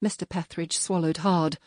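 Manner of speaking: ordinary delivery, nothing marked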